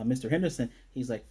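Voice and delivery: ordinary talk, nothing marked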